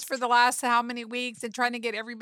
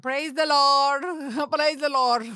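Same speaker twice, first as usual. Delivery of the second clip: put-on Scottish accent; 4 semitones higher, 7 dB louder, slower than usual. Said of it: "you're trying to get your Scottish accent?".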